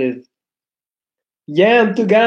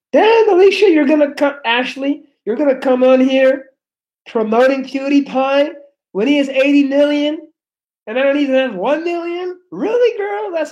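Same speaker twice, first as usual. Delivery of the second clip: mock outrage; 6 semitones higher than usual. Mock outrage at a chat commenter.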